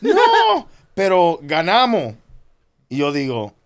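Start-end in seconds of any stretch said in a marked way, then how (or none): 0.0-2.2 mock gleeful wife. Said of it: "Y dice".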